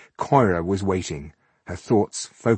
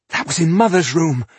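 high, loud alarmed cry; 9 semitones higher, 7 dB louder than usual. A narrator's reading. Alarmed cry after scream.

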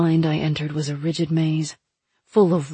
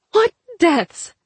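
high, loud desperate cry; 12 semitones higher, 6 dB louder than usual. A narrator's reading. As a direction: shout it after the vanishing oracle. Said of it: "I cried".

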